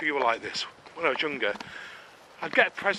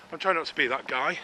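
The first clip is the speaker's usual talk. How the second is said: ordinary talk, nothing marked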